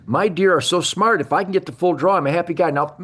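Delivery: ordinary delivery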